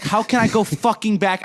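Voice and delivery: high-pitched mock desperation; retold panicked regret. Retelling wanting to take the question back, desperate. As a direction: replay your panic at a blunder, pitch up.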